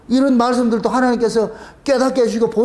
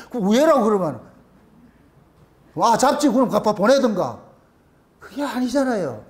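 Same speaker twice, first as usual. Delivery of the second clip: usual speaking voice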